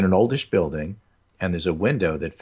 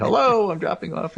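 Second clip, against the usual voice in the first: called-out yell; 13 semitones higher, 3 dB louder than usual. Retold or imitated. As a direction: call out loud on the hello, pitched way up. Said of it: "stick your head in the slot and yell".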